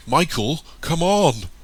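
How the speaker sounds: loud, high call; urgent shout. A narrator's reading. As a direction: yell for a friend to hurry. Calling friend across gunfight.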